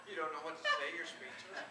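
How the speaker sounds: laughing hard through the words; teasing through loud real laughter. Real, not acted. Teasing the silent audience, laughing hard.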